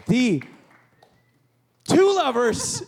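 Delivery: high, slow, loud hype announcement; big announcer call. Real, not acted. Host introducing the band to the crowd, loud emcee call. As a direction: call out the band's name like an emcee.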